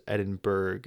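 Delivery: Edinburgh ending in a hard "burg"; mistaken pronunciation. Shown as a demonstration. Listing variants for listeners, Edinburgh said as "Edin-berg". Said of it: "Edinburgh is a great example".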